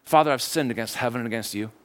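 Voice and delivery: low, subdued recital; rehearsed confession. Acted. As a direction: recite a practised apology, pitch dropped. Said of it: "he starts his rehearsed speech".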